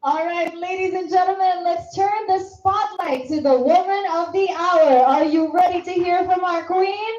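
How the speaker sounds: big booming emcee call to the crowd; rally the audience like an event host; hype announcer. Acted. Host presents the queen to the audience, booming emcee.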